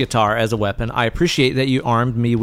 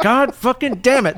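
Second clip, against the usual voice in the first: high-pitched aggravated groaning curse; mock exasperation; 11 semitones higher than usual. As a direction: curse in hammed-up dying frustration. Imagined victim's exasperation at quip.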